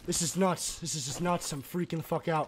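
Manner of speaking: hushed, trying to settle after a freak-out; calming self down. Real, not acted. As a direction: talk yourself down quietly. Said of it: "Deep breaths, Chief".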